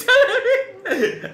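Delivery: high, laughing mock of her daughter; mother mimicking her daughter's demand, giggling; imitating daughter. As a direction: squeak it out, laughing, as the girl. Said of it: "She said".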